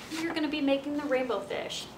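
plain reading voice

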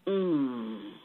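deep, soft groaning buffalo voice; dying buffalo. A narrator's reading. Dying buffalo answers the mouse.

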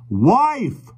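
a mock roar at the deaf stranger. A narrator's reading. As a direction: roar it at the deaf old man. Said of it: "roared John".

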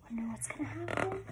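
fast hushed whisper of suspense. Acted. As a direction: lean in and whisper the cliffhanger. Building suspense before page turn.

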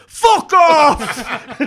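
bellowed high and loud swear at rival fans; retold shout. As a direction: belt it out like a furious football fan. Lanks swears at Barnet fans.

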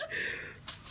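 airy, panicked laugh-scream; breathless shriek-laugh. Real, not acted. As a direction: let out a gasping shriek of a laugh.

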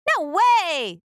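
put-on boy's breezy refusal; Jose's refusal. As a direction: brush her off. Jose refuses.

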